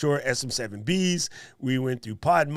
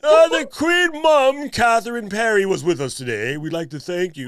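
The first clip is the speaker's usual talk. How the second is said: posh Queen Mum; 4 semitones higher, 6 dB louder than usual. Pretend. Host mock-announcing guest as royalty, posh Queen Mum voice. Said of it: "it sounds very, very posh".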